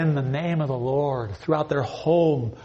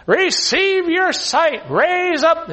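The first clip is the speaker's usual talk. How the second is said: much higher, louder slow faith-healer proclamation; imitating Benny Hinn; 13 semitones higher, 5 dB louder, much slower than usual. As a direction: ham up a TV healer declaring a cure. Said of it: "this Benny Hinn all the time saying".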